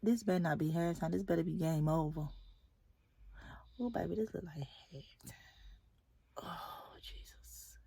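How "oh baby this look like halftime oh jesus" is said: whispered, much softer; real exasperated hush. Real, not acted. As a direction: whisper it in disbelief. Kids' game dragging past bedtime.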